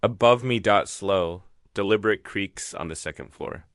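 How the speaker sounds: ordinary delivery, nothing marked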